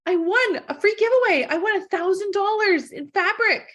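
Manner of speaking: hammed-up delight at a prize; thrilled announcement. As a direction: tell it like the best news of your week. Announcing winning a giveaway.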